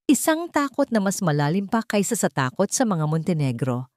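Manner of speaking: plain reading voice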